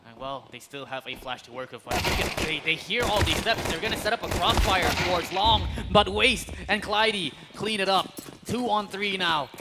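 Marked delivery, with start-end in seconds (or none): 1.8-9.5 rising, hyped play-by-play call; esports caster. Acted. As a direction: build the hype to the clutch. Live play-by-play of round.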